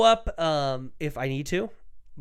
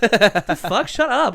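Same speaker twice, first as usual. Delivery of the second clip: exasperated; 7 semitones higher, 8 dB louder, much slower than usual. Retold or imitated. Annoyed outburst when misidentified.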